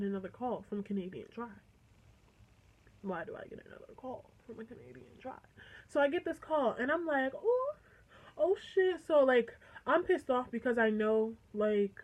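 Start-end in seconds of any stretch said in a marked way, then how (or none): 3.0-5.3 much quieter, under the breath; hushed aside. Real, not acted. Repeats the line as quiet aside. 7.3-9.0 surprised. Retold or imitated. Reacting to the surprise call.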